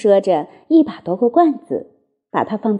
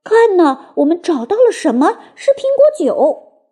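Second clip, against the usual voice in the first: put-on high, excited child fox voice; loud excited call; 6 semitones higher than usual. A narrator's reading. Littlest fox showing dad the cider he found, loud excited call. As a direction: cry out the discovery, pitched way up. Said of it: "他大声说".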